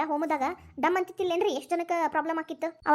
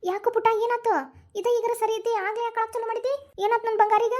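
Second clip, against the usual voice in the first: higher-pitched mother's coaxing; sing-song comforting baby talk; coaxing a child; 5 semitones higher than usual. Acted. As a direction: fuss sweetly over an upset little one.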